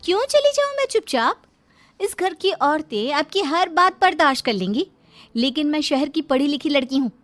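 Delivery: put-on high-pitched, indignant young bride; spirited, protesting daughter-in-law; defiant young wife.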